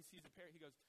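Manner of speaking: ordinary talk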